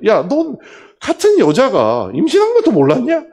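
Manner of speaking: loud, high acted rebuke; imagined telling-off at full voice; angry scolding. Director imagines scolding the team leader, loud and angry. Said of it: "화가 나는 거예요".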